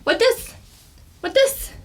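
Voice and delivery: put-on very high, loud toddler voice; toddler questioning. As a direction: imitate a curious two-year-old pointing things out. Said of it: "she says".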